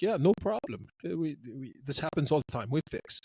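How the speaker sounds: lightly imitated Russian accent. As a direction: brush it off as an unbothered Russian. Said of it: "the Russians are like".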